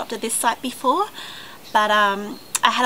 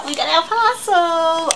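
higher, slower, bursting with joy; thrilled announcement; 6 semitones higher, 3 dB louder, slower than usual. Real, not acted. Vlogger telling viewers the parcel finally arrived, thrilled. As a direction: announce the win with delight.

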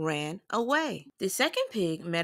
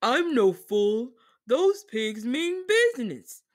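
louder, slow wolf voice; 3 semitones higher, 4 dB louder than usual. A narrator's reading. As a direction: grumble it as the beaten wolf. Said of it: "The wolf felt the smoke ... and said".

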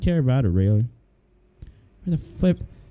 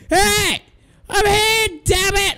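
exasperated calling out; 10 semitones higher, slower than usual. Real, not acted. Shopkeeper yelling at a customer who won't wait, exasperated shout. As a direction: shout across the shop, fed up.

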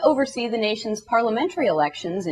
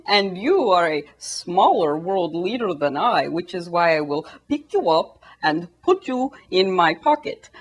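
put-on slow, deliberate Russian-accented Putin; slower than usual. Putin buddying up to a smaller world leader, slow Russian accent. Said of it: "this is my Russian accent".

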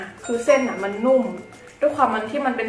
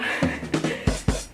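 breathy laugh; 5 dB quieter than usual. Real, not acted. Laughing about painful spice.

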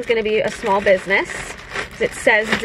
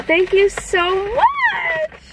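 high, drawn-out thanks; grateful squeal; 11 semitones higher, much slower than usual. Real, not acted. Vlogger thanks a viewer for the bottle, grateful squeal. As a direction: let the thanks climb into a long squeal.

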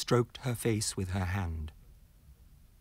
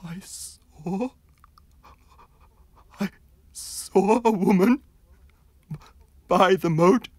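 much higher, louder panicked voice; terrified stammer; 11 semitones higher, 11 dB louder, slower than usual. Acted. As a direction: stammer it out in sheer terror. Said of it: "the terrified man".

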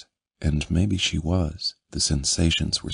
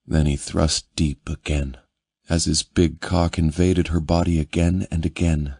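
ordinary delivery

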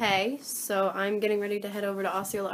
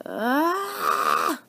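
mock frustrated yell; 4 semitones higher, 5 dB louder than usual. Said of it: "I'm like".

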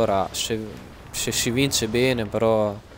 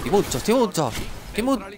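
excited yelling; 8 semitones higher, faster than usual. Real, not acted. Threatening enemy in game.

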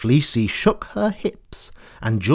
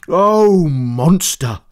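crying out; 3 semitones higher, 5 dB louder, much slower than usual. Acted. George hails the monster with a loud cry. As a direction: call out, arms open wide. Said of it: "he cried".

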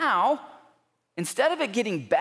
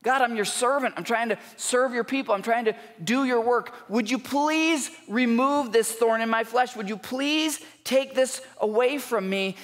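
pleading with God; 6 semitones higher than usual. Retold or imitated. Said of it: "and he pleads with God".

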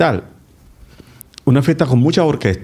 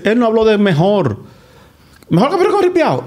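mocking quote; 8 semitones higher than usual. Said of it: "alguien que le dice".

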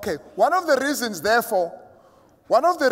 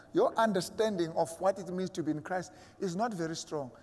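ordinary delivery

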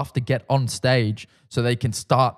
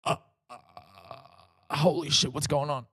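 panicked inner voice; 9 dB quieter, slower than usual. Retold or imitated. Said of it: "I'm like".

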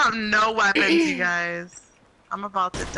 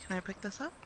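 whispering while hiding; 16 dB quieter, faster than usual. Real, not acted. Hiding from enemy players nearby.

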